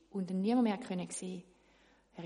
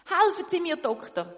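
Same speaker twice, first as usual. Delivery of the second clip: cry for help; 9 semitones higher, 8 dB louder than usual. Acted. Shoemaker begs for help.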